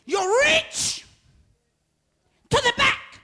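high, loud, slow preaching shout; whooping sermon cry; preaching at full force. Acted. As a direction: drive it home like a climax.

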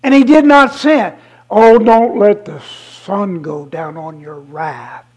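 ordinary delivery

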